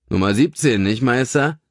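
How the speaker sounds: a hint of a gruff cab driver; lightly voiced cabbie. A narrator's reading. Taxi driver asking his passenger the house number. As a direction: play the chatty taxi driver.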